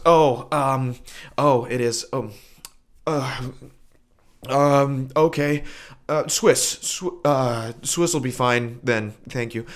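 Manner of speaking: a caught-out know-it-all fumbling for words; flustered customer. Retold or imitated. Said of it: "his entire persona evaporated".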